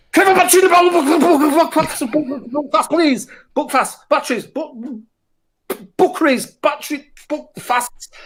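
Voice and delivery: high-pitched, hammed-up Belfast accent, garbled words; squeaky mock Northern Irish babble, stuck on book. To a shopkeeper, hammed-up high Belfast accent.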